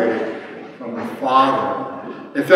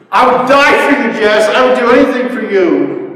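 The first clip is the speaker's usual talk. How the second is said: raised, high-pitched, fervent vow to a son; passionate declaration; 8 semitones higher, 5 dB louder than usual. Retold or imitated. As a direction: pour out devotion, loud and quick. Passionate declaration to his son, retold.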